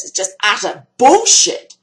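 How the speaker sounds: usual speaking voice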